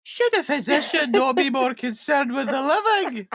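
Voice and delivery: very high, slow Scots voice with a laugh; high young Scottish. Acted. Maid challenging Claire, high Scottish through laughter. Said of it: "Young Scottish".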